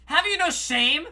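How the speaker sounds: indignant shout. Acted. Wife scolds, husband caves.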